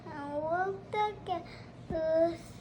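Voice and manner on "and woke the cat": ordinary delivery, nothing marked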